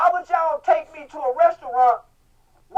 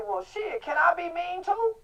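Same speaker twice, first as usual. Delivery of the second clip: mock innocent ask; 3 semitones higher, 8 dB quieter than usual. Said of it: "you see me say".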